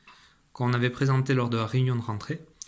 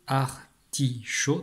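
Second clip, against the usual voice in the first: "Artichaut" slowly in three parts, ar-ti-chaut; split into syllables; much slower than usual. Shown as a demonstration. Teacher showing three syllables, sounded out slowly. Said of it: "trois syllabes".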